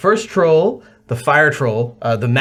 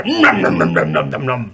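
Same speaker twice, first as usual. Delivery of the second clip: greedy troll "nom nom" munching; playful monster chomping; gobbling noises; 8 semitones lower than usual. Acted. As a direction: gobble it up noisily, dropping lower. Troll drinks in the fire.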